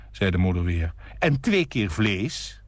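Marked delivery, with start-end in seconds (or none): 1.2-2.6 hasty call. A narrator's reading. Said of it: "riep hij nog haastig".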